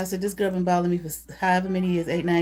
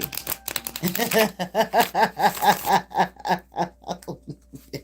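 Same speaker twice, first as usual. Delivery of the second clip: long laugh. Real, not acted. Laughing at the situation.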